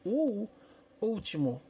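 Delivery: O último, first syllable isolated high, then the word; stress on first syllable. Shown as a demonstration. Teacher showing students which syllable is stronger, stressed first syllable. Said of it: "que é mais forte".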